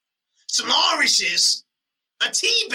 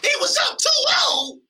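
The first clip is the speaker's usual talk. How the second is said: stunned question. Real, not acted. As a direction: ask it in shock. Reacting to cohost's fact.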